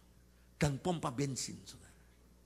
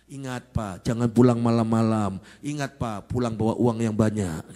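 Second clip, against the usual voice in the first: very loud, lower wifely admonition; mock stern reminder; 4 semitones lower, 17 dB louder than usual. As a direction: boom out orders to husband. Mock prayer turns into loud reminders. (peringatan istri yang lantang dibuat-buat)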